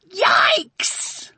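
a sudden high shrieked yell down the phone; loud shout. A narrator's reading. Sophie shouting down the phone to Aunt Al, high excited yell. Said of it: "came a loud shout of".

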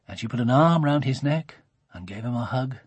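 ordinary delivery, nothing marked

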